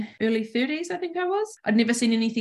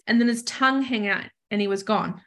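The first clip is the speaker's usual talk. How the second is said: ordinary talk, nothing marked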